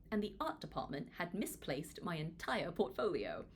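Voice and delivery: plain reading voice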